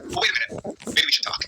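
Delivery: high, sly change of heart; mock Triple H scheming. Host retelling his booked Triple H plot, lightly scheming. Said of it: "then suddenly Triple H goes".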